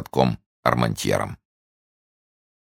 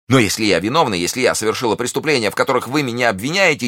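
wild scream; 9 semitones higher, faster than usual. A narrator's reading. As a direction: scream at the accusers. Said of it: "дико закричала миледи". (дикий крик, высоко и громко)

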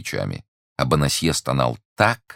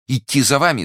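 loud, high-pitched exclamation; alarmed cry; 12 semitones higher, 6 dB louder than usual. A narrator's reading. Prisoner told at night to follow an official, crying out in alarm. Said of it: "вскричал Бонасье".

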